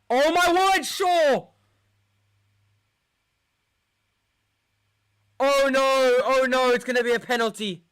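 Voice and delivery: high, loud alarm; panicked shouting. Real, not acted. Fan panicking at the screen over a handball. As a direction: cry out as the penalty looms.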